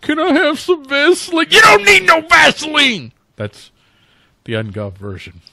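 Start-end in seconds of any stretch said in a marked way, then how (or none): 0.0-1.5 high mock whimpering plea; pleading victim. Host acting a skit, victim begging in a high whimper. 1.5-3.1 high, loud mock yell; bully shout. Host acting a skit, bully yelling at the victim.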